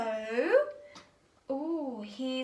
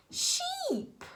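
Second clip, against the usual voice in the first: much higher, soft squeal of delight; 13 semitones higher, 7 dB quieter, slower than usual. Real, not acted. To Tim, revealing the sheep, a high soft squeal. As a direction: squeal it like a happy surprise.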